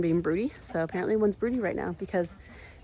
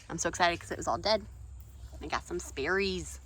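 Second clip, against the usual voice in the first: normal talking voice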